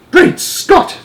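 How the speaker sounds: very high breathless gasp of a cry; a regal shocked exclamation. Acted. Queen sees the headline, high shocked cry. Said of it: "cried the famous voice".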